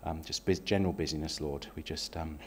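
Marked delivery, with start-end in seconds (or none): none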